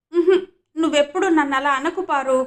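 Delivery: put-on high, loud childish pout with a little laugh; sulky little boy. Raghubabu accuses Parvati, pouting like a child with a laugh.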